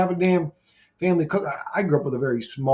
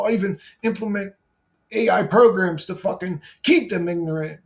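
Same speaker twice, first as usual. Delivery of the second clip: higher, ranting voice of a God choosing ignorance; mock God monologue; 6 semitones higher than usual. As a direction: play a God who wants to stay dumb, ranting. Voicing God's inner monologue.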